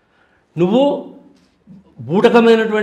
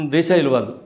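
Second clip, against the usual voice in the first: usual speaking voice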